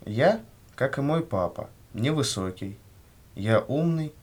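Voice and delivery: ordinary delivery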